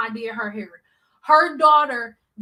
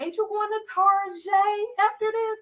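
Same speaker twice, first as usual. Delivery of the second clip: coaxing; 8 semitones higher than usual. Retold or imitated. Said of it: "I was like".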